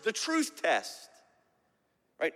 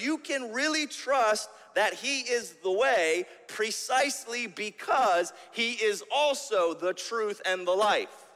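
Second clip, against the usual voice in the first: usual speaking voice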